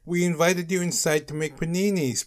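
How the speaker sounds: high-pitched retort; shouting. A narrator's reading. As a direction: shout back like an insistent kid. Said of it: "Baby Giraffe shouted".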